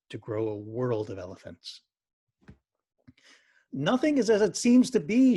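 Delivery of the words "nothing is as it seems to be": high, loud call ringing out. A narrator's reading. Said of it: "she called out".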